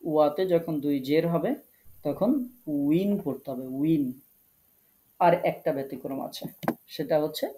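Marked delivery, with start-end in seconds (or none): 2.0-4.1 slow উইন, drawn out; win ending. Shown as a demonstration. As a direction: repeat উইন slowly for students. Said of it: "আবার বলছি". (ধীরে উইন)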